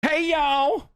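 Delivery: high-pitched put-on Southern greeting; mock Alabama accent. Podcaster parodying sorority girls who larp as Alabamian, mock Southern accent. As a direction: exaggerate the Alabama sorority twang. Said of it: "doesn't talk like this".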